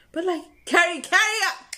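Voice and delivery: high, loud, insistent repeat; urgent self-urging. Retold or imitated. Distracted singer urging herself to continue, loud and high. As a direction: push it out high and loud, pressing yourself onward.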